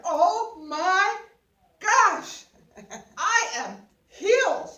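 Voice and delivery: high, loud joyful thanks; retold elated cry of praise; ecstatic praise. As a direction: burst out thanking Jesus, overjoyed. Joyful outburst on plane.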